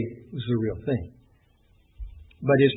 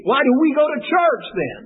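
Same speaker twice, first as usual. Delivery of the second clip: frustrated outburst; 10 semitones higher, 4 dB louder than usual. Retold or imitated. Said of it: "she just got real frustrated".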